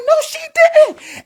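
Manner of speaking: very high, drawn-out amazed squeal; can't believe she pulled it off, voice sky-high; slow, high-pitched exclamation of delighted shock; astonished disbelief. Retold or imitated. Astonished reaction to a saved stunt.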